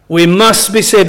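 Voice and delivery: "must" hit firmly as the key word; emphasis on MUST. Shown as a demonstration. Preacher repeating the verse for the congregation, stressed MUST. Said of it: "and notice the emphasis".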